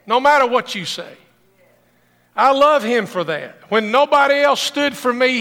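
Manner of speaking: loud, high, fervent sermon climax; preaching at full force. Acted. Pastor preaching to his congregation, loud and fervent. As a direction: build the congregation up with passion.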